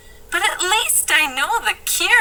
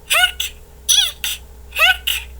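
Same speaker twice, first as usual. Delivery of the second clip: hiccup; 14 semitones higher, slower than usual. Acted. [name] hiccuping.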